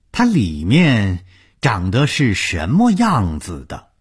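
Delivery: slow, drawn-out, relishing the cruelty; menacing gloating. Acted. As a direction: stretch the words tauntingly, pitch swooping up high. Villain announces he will dissect body. (拖长音调、阴森得意地威胁)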